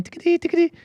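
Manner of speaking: high, rapid ticket-machine chatter; imitated ticket printer.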